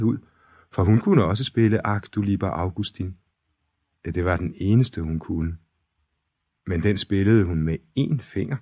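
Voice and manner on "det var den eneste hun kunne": ordinary delivery, nothing marked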